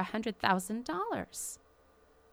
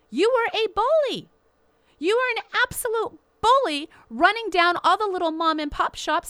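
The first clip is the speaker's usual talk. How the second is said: loud, higher, indignant outburst; raised voice of a host attacking Bill Gates; angry accusation; 8 semitones higher, 5 dB louder than usual. Real, not acted. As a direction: call him out loudly as a bully.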